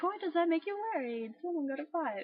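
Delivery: put-on puzzled protest; incredulous questioning. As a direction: act out a baffled objector. Acting out puzzled viewers' objection.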